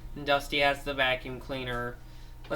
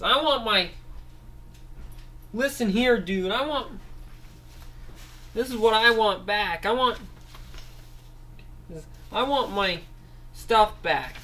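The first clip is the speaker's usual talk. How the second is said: demanding Dusty figure; 7 semitones higher than usual. Acted. Dusty demands his vacuum back from the thief, high insistent voice. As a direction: demand your stuff back, tough.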